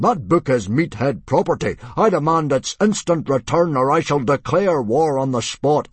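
loud, high indignant demand; furious blustering chieftain; booming outrage. A narrator's reading. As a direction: threaten war at full bluster. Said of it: "boomed Mogadon".

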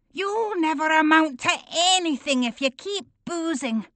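high whiny mimic of wife; mock her nagging whine. A narrator's reading. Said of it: "He fakes a whiny voice".

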